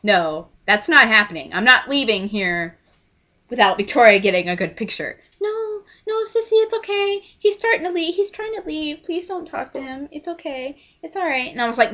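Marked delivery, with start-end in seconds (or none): 5.4-11.5 high, small imitation voice; pleading sister. Sister begging her not to bother the actor, pleading. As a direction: beg meekly in a high-pitched voice, softening at the end.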